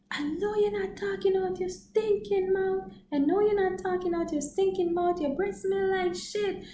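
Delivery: high-pitched mock tell-off; sassy mock wife. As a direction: roast him with attitude. Wife roasting stinky-breath man.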